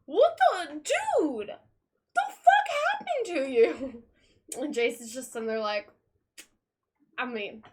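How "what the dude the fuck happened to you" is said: high, loud, strained shriek of disbelief; shocked Tim. Retold or imitated. Tim shrieking at injured Jason, shocked and high. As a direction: shriek it at the wounded brother. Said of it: "And Tim's like".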